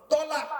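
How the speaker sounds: তলাক, short first vowel, high and loud; correct form. Shown as a demonstration. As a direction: punch out তলাক sharply. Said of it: "শব্দটা হলো".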